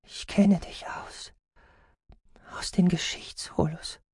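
slow, high whisper; frail and breathy, high-pitched; weak patient. Acted. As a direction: whisper it haltingly, as if drained. Weak patient struggling to speak.

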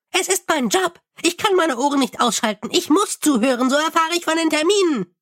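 high, chirpy little imp. Acted. Imp soothes the angry Mumm, high and chirpy. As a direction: pipe it up high like a tiny helpful imp.

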